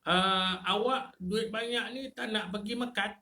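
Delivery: high, teasing quote of a friend; lightly voiced busybody urging you to Mecca; a hint of a prodding neighbour; mock nagging friend. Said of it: "Pernah ke kita dengar?".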